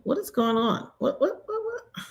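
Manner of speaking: rapid high bewildered whats; alarmed. Real, not acted. Computer closing itself, bewildered squeak. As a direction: squeak it out in confusion.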